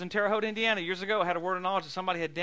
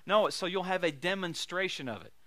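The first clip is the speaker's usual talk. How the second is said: normal talking voice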